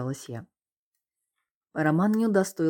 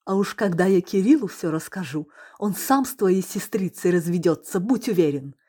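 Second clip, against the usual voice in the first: louder, higher angry retort; raised voice; 5 semitones higher, 7 dB louder than usual. Acted. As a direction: yell at him in outrage. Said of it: "повысила голос Лоботская".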